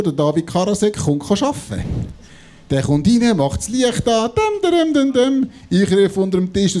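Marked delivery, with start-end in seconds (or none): 4.3-5.5 imitated lights sound.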